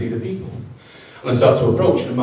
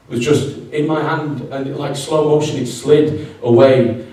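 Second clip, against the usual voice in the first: usual speaking voice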